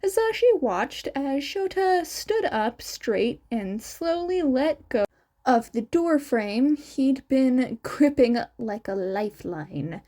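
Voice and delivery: plain reading voice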